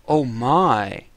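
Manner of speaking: ordinary delivery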